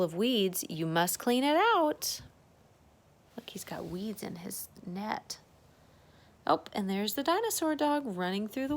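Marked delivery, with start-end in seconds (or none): 3.4-5.4 soft whisper; whispered aside. Real, not acted. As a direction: point it out in a whisper. Hushed aside while reading.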